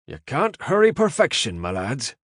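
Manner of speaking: thick Irish brogue, pitched high and loud; an agitated Irish safecracker needling his impatient partners. A narrator's reading. Safecracker to hurrying Yanks, thick Irish brogue. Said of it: "His Irish brogue was quite thick".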